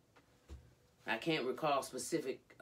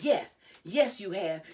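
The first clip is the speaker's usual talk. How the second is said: loud, high retort; heated insistence; 7 semitones higher, 9 dB louder, slower than usual. Retold or imitated. Christy contradicting Jade in a quarrel, loud insistence. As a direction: re-enact arguing back forcefully. Quoting mother arguing back.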